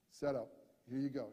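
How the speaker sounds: usual speaking voice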